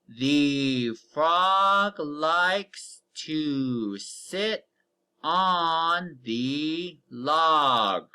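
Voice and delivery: very slow, word by word, each word drawn out; very slow read-aloud. Shown as a demonstration. Teacher reading a sentence to the class, very slowly. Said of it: "Read it with me".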